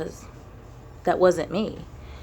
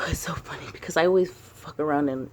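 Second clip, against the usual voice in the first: ordinary talk, nothing marked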